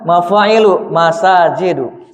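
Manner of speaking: "masajidu" drawn out slowly after "Mafa'ilu"; slow comparison. Shown as a demonstration. Teacher showing the student the shared pattern slowly. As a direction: read the pair slowly so the match is heard. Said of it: "Kalau ini sama kan?".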